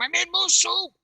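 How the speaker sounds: very high mock holler; imitated yelling. Teller as woman yelling at boater, high imitation. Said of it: "She's yelling at him".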